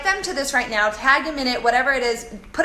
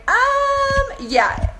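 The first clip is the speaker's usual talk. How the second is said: sheepish um yeah; 13 semitones higher, 4 dB louder than usual. Retold or imitated. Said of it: "And I'm like".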